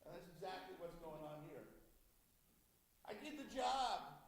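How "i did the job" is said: put-on childish claim. Saul as child claiming done.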